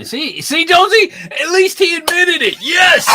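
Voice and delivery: high-pitched gloating cheer; triumphant teasing. Real, not acted. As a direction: crow in victory at your friend.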